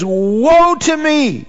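high, drawn-out cry of woe; dramatic, high-pitched outcry. Acted. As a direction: cry out high and slow, as if you can't help it. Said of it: "He cries out and says".

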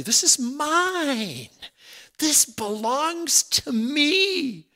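high, slow, possessive claim; high, drawn-out "this is mine"; drawn-out declaration. Pretend. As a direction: say it slowly like a claim of ownership. Claiming truth as own, drawn out.

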